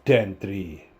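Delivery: low-pitched attempt at a Jamaican accent. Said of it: "that was Jamaican".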